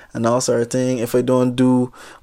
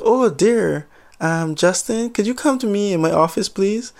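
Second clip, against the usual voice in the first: high, fluttery call, much higher; a hint of a nervous boss, lightly voiced; timid supervisor; 7 semitones higher than usual. Supervisor calls Justin into her office, high and timid.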